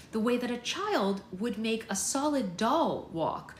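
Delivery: plain reading voice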